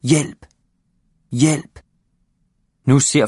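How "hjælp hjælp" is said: calling for help. A narrator's reading. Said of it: "bliver ved med at råbe".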